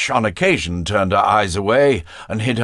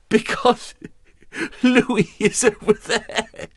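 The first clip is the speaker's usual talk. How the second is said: high voice through laughter; laughing; 13 semitones higher than usual. A narrator's reading. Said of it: "Marguerite burst out laughing".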